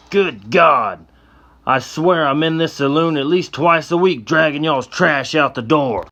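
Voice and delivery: a mean sheriff disgusted with the mess; gruff sheriff. Acted. Sheriff figure voiced.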